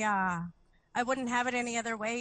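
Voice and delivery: normal talking voice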